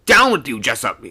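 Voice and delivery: quick, loud hurried command; rapid order. A narrator's reading. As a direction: rattle it off urgently. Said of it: "speaking rapidly".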